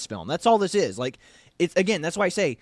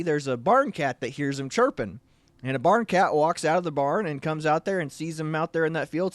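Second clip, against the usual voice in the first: normal talking voice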